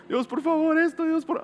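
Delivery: high, pinched mock begging; desperate whiny plea. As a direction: whimper it like a panicked prayer. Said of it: "tú estás en tu cabeza llorando".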